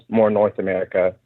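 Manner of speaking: ordinary delivery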